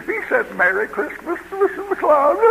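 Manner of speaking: very high-pitched, hammed-up outrage; mock scandalized churchgoer. Church folks tattling on the preacher, mock scandalized. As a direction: squeal it like an appalled gossip.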